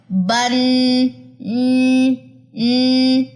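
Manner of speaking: بن with the ن drawn out into a humming nasal ن; نون غنہ کی آواز کھینچ کر; hidden noon sound. Shown as a demonstration. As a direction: hold the ن of بن as a ringing nasal hum. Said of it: "آخر میں نون کی چھپی ہوئی آواز آ رہی ہے".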